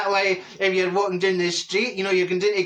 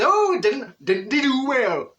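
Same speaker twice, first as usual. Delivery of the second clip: high, loud showman impression; hammed-up catchphrase, voice pitched up; Bruce Forsyth; 7 semitones higher, 4 dB louder, slower than usual. Comedian mimics the Strictly host, hammed-up impression. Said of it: "I don't know what accent that is".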